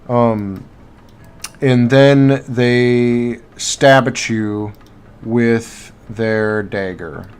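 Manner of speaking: ordinary talk, nothing marked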